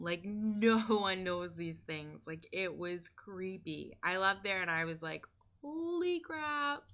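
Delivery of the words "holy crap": stunned holy crap. Retold or imitated. Leaving the reader, stunned.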